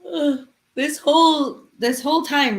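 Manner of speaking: talking through a big yawn. Real, not acted. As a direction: yawn while you speak. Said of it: "you got me yawning".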